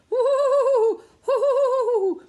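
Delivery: high, loud wind howl. Acted. Reader making the wind noise, loud high howl. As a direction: howl like a blizzard.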